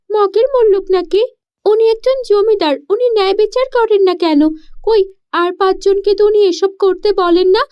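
put-on a girl refusing injustice, words tumbling out; indignant girl.

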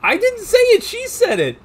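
high, loud mock denial; playful protest. Real, not acted. Streamer blaming his co-host, high loud protest. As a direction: squeak out an innocent denial.

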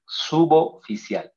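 "suboficial" slowly, B joined to the O; joined syllables. Shown as a demonstration. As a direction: slide B into O in "suboficial". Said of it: "La consonante y la vocal se juntan".

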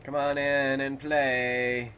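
loud, slow singsong invitation; calling out. Pretend. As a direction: call them over, stretching the words.

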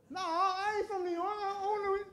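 high, drawn-out mock New York accent; singsong New York accent protest; hammed-up New York accent. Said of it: "it sounds like Joey from Friends".

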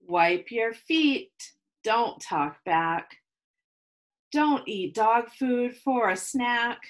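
measured rhyming read-aloud with pauses; reading to children. Acted. Teacher reading a picture book to her class, storytelling. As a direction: read each rule out to the kids, lingering.

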